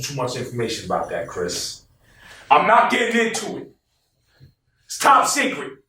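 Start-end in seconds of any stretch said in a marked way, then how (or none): 2.5-5.8 very high-pitched teasing refusal; playful secrecy. Real, not acted. Guest refusing to reveal tour plans, playful secrecy. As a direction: squeal it like a guarded secret.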